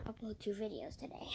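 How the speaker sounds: fast whisper with no voice; a rapid, voiceless whisper about uploads. Acted. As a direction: hurry it out in a breathy hush. Unvoiced whisper.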